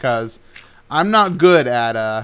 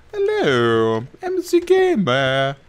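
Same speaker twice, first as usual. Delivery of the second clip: silly greeting; much slower than usual. Pretend.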